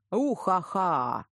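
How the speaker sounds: loud, drawn-out ghostly cackle; playing along with a big witchy ha-ha; mock spooky laugh. A narrator's reading. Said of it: "охотно подыграла я". (громкий наигранный зловещий хохот)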